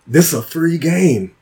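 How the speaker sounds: high, loud incredulous protest; retold exasperation, building high and loud; mock disbelief. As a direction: reason with a raging gamer, voice climbing. Retold plea to raging opponent.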